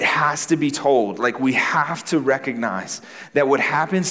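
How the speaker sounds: driving the sermon home with mounting conviction; preaching at full force. Real, not acted. Pastor urging the congregation toward hope, preaching at full force. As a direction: preach it like the whole room must hear.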